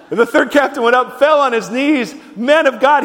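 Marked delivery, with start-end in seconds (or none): 2.4-3.0 mild plea, higher and louder; begging. Acted. Captain on his knees to Elijah, mildly begging. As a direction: plead a little, voice raised. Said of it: "he begged".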